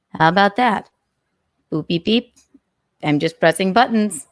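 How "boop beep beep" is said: ordinary delivery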